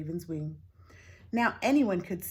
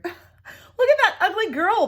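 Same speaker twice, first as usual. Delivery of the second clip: high, loud taunting villagers; mocking jeer; 13 semitones higher, 13 dB louder, slower than usual. A narrator's reading. Villagers mocking the girl, loud high jeering. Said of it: "they laughed".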